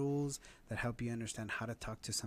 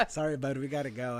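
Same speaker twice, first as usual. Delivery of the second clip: laughing; 2 semitones higher, 8 dB louder than usual. Retold or imitated. Parent retelling, laughing.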